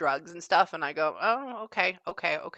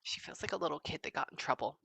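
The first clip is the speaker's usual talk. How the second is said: whispering; 4 semitones lower, 10 dB quieter, faster than usual. Real, not acted. Medium relays, whispering.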